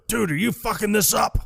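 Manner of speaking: high-pitched retold holler; mock yell. Walter yelling over during the ransom call, high mock yell. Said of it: "Walter just yells over".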